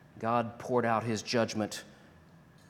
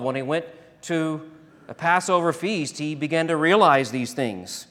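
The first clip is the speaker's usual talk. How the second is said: loud, fervent preaching; preaching at full force; 8 dB louder than usual. Acted. Preacher pressing the cross on his congregation, loud and fervent. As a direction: rise up and preach it hard.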